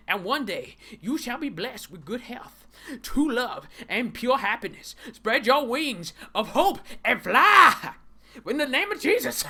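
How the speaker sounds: high, hammed-up blessing; mock preacher. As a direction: build to a loud preacher's climax.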